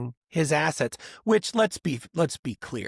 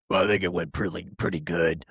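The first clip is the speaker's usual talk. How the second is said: much lower, put-on deep athlete voice; mocking a very deep interview voice; deep-voiced imitation; 10 semitones lower, faster than usual. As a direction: drop way down for the post-game interview. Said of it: "she has a voice where it's... that low".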